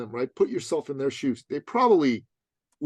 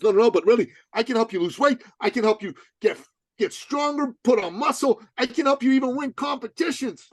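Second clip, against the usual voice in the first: higher, louder insistent voice; lightly raised mock insistence; eager client; 9 semitones higher, 3 dB louder than usual. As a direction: imitate the overeager client pleading his case. Dan insisting, voiced by speaker.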